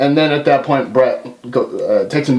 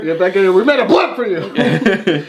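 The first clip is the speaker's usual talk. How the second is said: laughing; 5 semitones higher than usual. Retold or imitated. Mock Vince, laughing.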